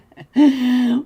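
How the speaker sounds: loud laugh. Real, not acted. Caster laughs.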